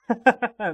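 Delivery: loud, high burst of laughter; amused laugh. Acted. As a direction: laugh at the very idea. Laughing at being called a couple.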